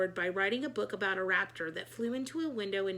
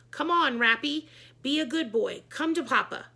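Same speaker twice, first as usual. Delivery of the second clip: higher, louder coaxing call; 4 semitones higher, 6 dB louder than usual. A narrator's reading. Principal coaxing the raptor down, higher and louder. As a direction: lure the bird like a pet.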